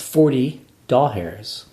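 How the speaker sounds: DOLLARS drawn out as "doll hairs"; mocking pronunciation. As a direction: slowly mimic DOLLARS as two words, "doll hairs". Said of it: "makes fun of how I say dollars".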